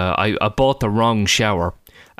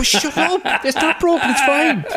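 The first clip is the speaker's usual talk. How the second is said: flustered dad hushing; 13 semitones higher than usual. Retold or imitated. Father hushing son on phone.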